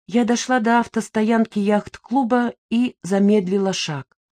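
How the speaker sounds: usual speaking voice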